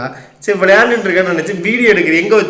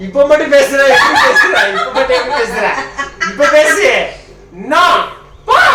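laughing through the words; talking while breaking into laughter; 5 semitones higher than usual. Real, not acted. Mother laughing at her child's sudden talk, laughing.

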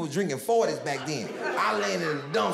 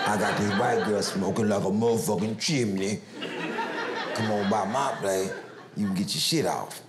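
much lower, laid-back hustler voice; imitated dealer; 9 semitones lower than usual. Dealer inviting the teen over, low and smooth. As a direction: drop way down and lean in smooth.

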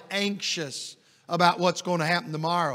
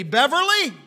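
calling her name; 7 semitones higher, 4 dB louder than usual. Retold or imitated. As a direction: call it out high, falling at the end. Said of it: "this is the way she said it".

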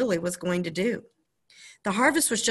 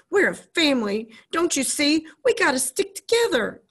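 loud, rising, desperate plea; boy shouting; 8 semitones higher, 5 dB louder than usual. Acted. Boy shouting at the doctor, loud and high. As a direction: shout it higher and higher.

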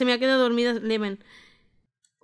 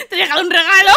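laughing; 7 semitones higher, 9 dB louder, much faster than usual. Real, not acted. Voices baby, laughing. (riendo a carcajadas)

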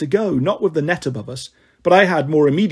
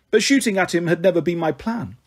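plain reading voice

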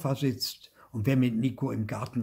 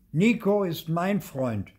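possessive claim; 7 semitones higher, 4 dB louder than usual. A narrator's reading. New boy claims Nico.

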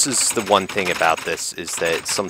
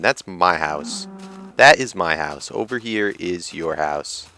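ordinary talk, nothing marked

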